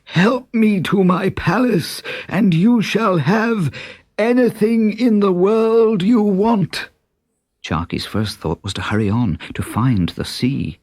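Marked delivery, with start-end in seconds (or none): 0.0-6.9 king crying out. A narrator's reading. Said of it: "he cried out".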